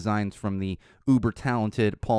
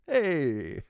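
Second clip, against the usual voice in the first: hammed-up, high Jersey accent; drawn-out mock Jersey call; 6 semitones higher than usual. Host clowning with a greeting to friends, Jersey accent. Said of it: "or like a Jersey accent".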